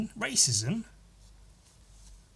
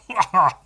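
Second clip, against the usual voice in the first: quick laugh at his own joke; short laugh; 6 semitones higher, 8 dB louder than usual. Real, not acted. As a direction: burst out laughing. Laughing at own British bit.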